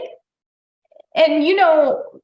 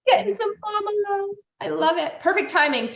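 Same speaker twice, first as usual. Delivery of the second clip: high, cooing pet voice; 11 semitones higher than usual. Real, not acted. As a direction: gush over the puppy in her arms. Cooing over the dog.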